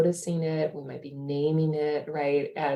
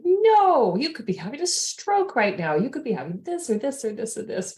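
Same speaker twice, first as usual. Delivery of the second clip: sudden high panicked outburst that trails off; mock alarm, doctor fearing a stroke; freaking out; 7 semitones higher than usual. Doctor panicking to her mom, in mock alarm. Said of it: "of course we freak out".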